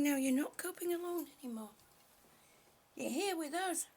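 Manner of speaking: normal talking voice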